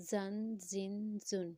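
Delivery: زن drawn out with a sharp whistling Z. Shown as a demonstration. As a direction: let the Z whistle in each slow زن. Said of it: "سٹی کی آواز آئے گی".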